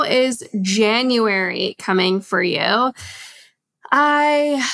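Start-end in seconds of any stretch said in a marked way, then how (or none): 3.9-4.7 long audible exhale; big deep sigh. Real, not acted. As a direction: let out a heavy sigh. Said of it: "big, deep sigh".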